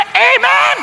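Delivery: a leader's drawn-out amen, lightly mocked. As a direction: ham up the leader's call.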